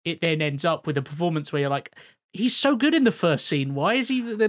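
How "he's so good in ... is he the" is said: loud, high-pitched retold bewilderment; baffled complaint. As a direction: act out an exasperated viewer, raised voice. Quoting a puzzled film viewer.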